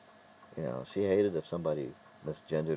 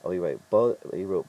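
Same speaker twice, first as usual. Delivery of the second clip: usual speaking voice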